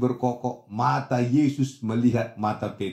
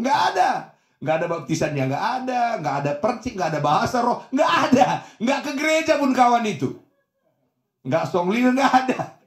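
high, forceful bursts on each negation; vehement denial; 9 semitones higher, 5 dB louder than usual. Real, not acted. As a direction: hammer the list, leaping up in pitch. Preacher hammers repeated denials. (menegaskan berulang dengan nada tinggi)

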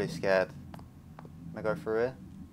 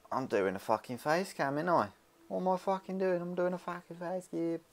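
mock disbelief, high; 8 semitones higher, 5 dB quieter than usual. Real, not acted. Self-mocking disbelief about face cam.

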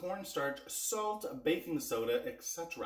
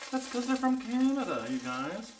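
playful; 8 semitones higher than usual. Pretend. Playful Canada pride.

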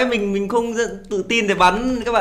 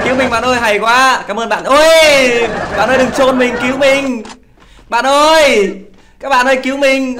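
higher, louder pleading calls; mock plea for help; 5 semitones higher, 4 dB louder than usual. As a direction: whine for rescue like a helpless kid. Begging teammates not to bury him. (giả giọng van nài kêu cứu, cao và to)

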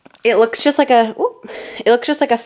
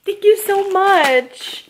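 gushing thanks; 9 semitones higher, much slower than usual. Real, not acted. Thanking the gift sender.

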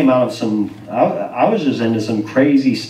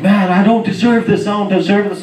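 raised-pitch imitation of a crying man; mock tearful disbelief; retold tearful protest; 6 semitones higher than usual. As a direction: plead it higher, as if overwhelmed. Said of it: "he gets up and he's crying".

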